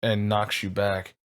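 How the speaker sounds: ordinary talk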